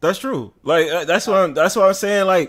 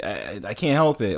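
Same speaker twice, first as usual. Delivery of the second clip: ordinary delivery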